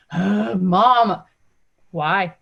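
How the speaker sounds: put-on mildly drawn-out, unimpressed kid's reply; a hint of a fed-up child; bored kid. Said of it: "bored voice".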